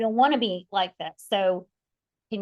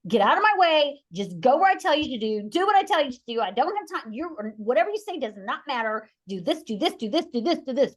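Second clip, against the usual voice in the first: control mode; 4 semitones higher than usual. Retold or imitated. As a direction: bark commands like a drill sergeant. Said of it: "I'm in full control mode".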